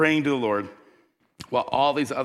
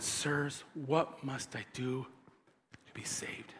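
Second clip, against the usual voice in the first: much softer, slowing to a whisper; hushed plea; 15 dB quieter, slower than usual. Retold or imitated. Trembling jailer asking Paul and Silas, soft whisper. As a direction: whisper it in awe. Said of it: "brought them out and said".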